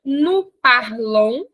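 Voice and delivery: "Tu parles, il parle" spoken very slowly and clearly; careful teacher model; slow conjugation demo. Shown as a demonstration. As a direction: spell out each form slowly. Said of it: "Repete comigo".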